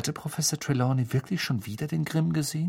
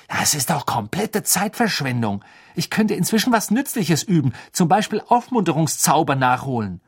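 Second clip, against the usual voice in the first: Hermine fed up; 5 semitones higher, 5 dB louder than usual. Acted. Hermine annoyed at wasted time.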